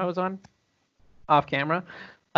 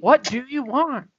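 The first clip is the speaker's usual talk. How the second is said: higher, drawn-out plea; acted movie quote; 8 semitones higher, slower than usual. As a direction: slowly act out the character's demand. Acting out the movie's line.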